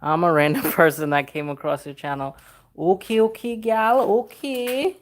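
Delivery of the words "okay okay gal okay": high, slow mock Caribbean okay gal; lilting island-accent greeting, stretched out. Reading a viewer's comment in a mock Caribbean accent.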